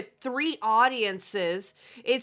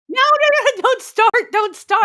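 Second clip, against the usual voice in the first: high, very loud pleading to stop; raised-voice repeated objection; loud protest; 10 semitones higher, 11 dB louder than usual. Real, not acted. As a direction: cut in urgently to break up the bickering. Co-host begging them not to fight.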